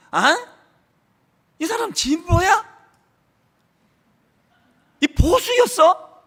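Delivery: high, startled, drawn out at the end; a churchgoer turning on a neighbour, in mock shock; mock surprise. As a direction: act shocked to find out his politics. Voicing a shocked church member.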